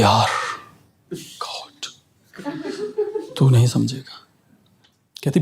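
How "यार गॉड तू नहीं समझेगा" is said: exasperated sigh. Retold or imitated. Girlfriend quoted exasperated.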